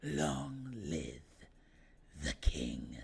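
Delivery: a hint of a deep regal king, very soft; hushed royal proclamation; Mufasa. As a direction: intone it like a lion king. Mufasa line from Lion King.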